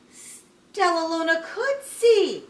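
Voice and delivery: loud, high, slow exclamation; amazed narration. A narrator's reading. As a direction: announce it as a drawn-out wonder. Narrator tells the amazing discovery.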